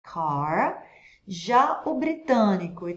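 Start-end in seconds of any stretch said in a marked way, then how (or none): 0.0-0.9 "Car" with a strongly curled final R; American R in "Car". Shown as a demonstration. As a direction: curl the tongue on the R of "Car". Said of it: "enrola essa língua nesses R's".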